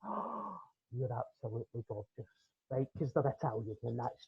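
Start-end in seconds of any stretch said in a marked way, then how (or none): 0.0-2.3 low, soft awed murmur; gasp, then murmur it in awe; hushed admiration. Acted. Gazing at stylish Italians, hushed admiration.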